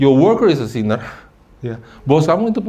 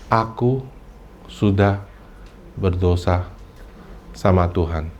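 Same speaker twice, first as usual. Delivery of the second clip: much lower and soft, slow with pauses on "Aku sudah berdosa sama Tuhan"; humble confession; 6 semitones lower, 5 dB quieter, slower than usual. Shown as a demonstration. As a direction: confess it quietly, word by word. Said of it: "Lihat nggak bedanya?". (pengakuan rendah hati, pelan dan lirih)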